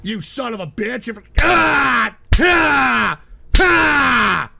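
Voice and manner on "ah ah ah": raging yell at the screen; furious outburst. Real, not acted. Streamer cursing a troll, screaming furious. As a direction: curse then scream in fury. Ranting streamer yells at viewers.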